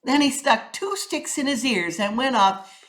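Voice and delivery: plain reading voice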